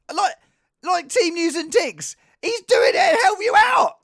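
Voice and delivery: high, loud indignant shout; mock outrage. Streamer defending the video to complainers, indignant shouting. Acted rebuke to complaining viewers.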